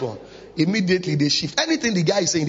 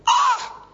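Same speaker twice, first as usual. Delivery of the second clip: sharp high gasp of surprise; a startled "Ah!" as it dawns on him; high surprised exclamation; sudden "Ah!" of realization. Retold or imitated. Sudden realisation mid-story.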